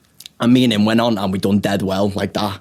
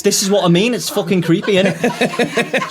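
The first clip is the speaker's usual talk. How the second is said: higher, amused protest through laughter; laughing; 9 semitones higher than usual. Real, not acted. Comic admitting to co-host the story is creepy, laughing.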